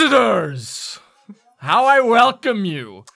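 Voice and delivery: high, loud showman's welcome called up from far below; non-imp-like booming bark. Acted. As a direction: belt out a drawn-out, grand greeting. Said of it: "barks up in a voice and says, in a very non-imp-like voice".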